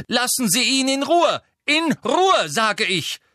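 high, loud, commanding cry; calling out. A narrator's reading. McGonagall defending Hagrid through the darkness, shouting. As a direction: order them off from a distance. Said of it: "rief Professor McGonagall durch die Dunkelheit".

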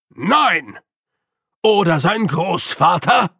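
loud, indignant argument; the Master scolding Grütze about the newcomer; scornful rebuttal. A narrator's reading. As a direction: hammer home each question with contempt.

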